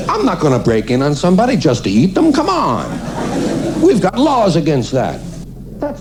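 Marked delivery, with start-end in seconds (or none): none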